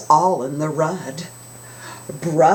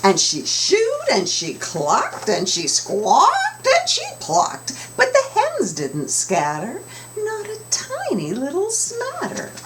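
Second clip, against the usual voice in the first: put-on lilting, expressive read to children; storybook narration, big pitch swings; read-aloud storytelling; 12 semitones higher than usual. A narrator's reading. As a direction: sing the rhyme to the kids. Rhyming narration.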